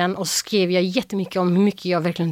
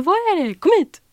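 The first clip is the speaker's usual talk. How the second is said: high, raised summoning voice; calling out; 11 semitones higher than usual. Retold or imitated. Dad summoning the screaming daughter, calling out. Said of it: "ropar min pappa på mig".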